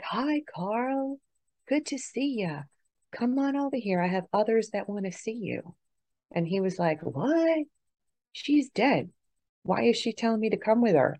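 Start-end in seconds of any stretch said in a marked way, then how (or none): 0.0-5.8 higher, lightly voiced kindly aunt; a lightly played welcoming aunt, pitched up; aunt's greeting. Dead aunt greeting her dad in the light, lightly voiced and higher. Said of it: "And she was like". 7.0-11.2 much higher, baffled mock disbelief; imitating a bewildered father's protest; confused dad. Dad startled at seeing his dead aunt, higher mock confusion. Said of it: "it confused him".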